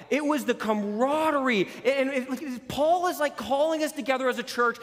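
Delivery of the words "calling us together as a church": usual speaking voice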